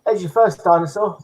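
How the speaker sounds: ordinary talk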